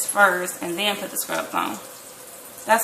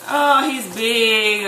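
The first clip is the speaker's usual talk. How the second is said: loud, long-drawn mock proud announcement; hammed-up gushing; imagined gushing; 3 semitones higher, 6 dB louder, much slower than usual. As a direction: stretch it out like an imagined proud dad. Acting out the expected gushing reply.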